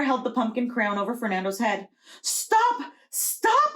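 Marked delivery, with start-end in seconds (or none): 2.2-3.7 very high shrill call; crying stop. Acted. Old Juana halting the mayor at the fiesta, very high cry. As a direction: stop the crowning with a piercing cry. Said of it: "old Juana cried".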